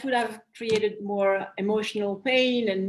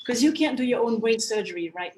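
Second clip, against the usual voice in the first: ordinary talk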